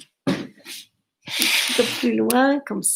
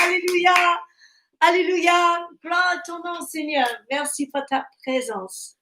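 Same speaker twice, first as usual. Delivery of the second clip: fervent praise; 6 semitones higher than usual. Real, not acted. Praise called out after the hymn.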